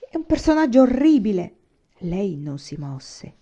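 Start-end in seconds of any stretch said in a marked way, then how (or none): 0.1-1.6 esclamato più alto e più forte; esclamazione. A narrator's reading. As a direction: grida il disgusto per quell'uomo. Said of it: "esclamò". (Aiwan esclama a Tamà, più alto e forte)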